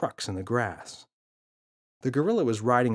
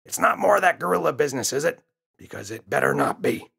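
gruff warning father, fast and higher; stern father; 3 semitones higher, 5 dB louder than usual. A narrator's reading. Father warning James from behind the paper, stern and quick. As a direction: warn the boy off impatiently.

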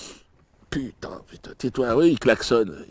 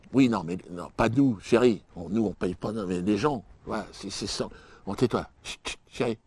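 quiet aside; 8 semitones lower, 13 dB quieter than usual. Acted.

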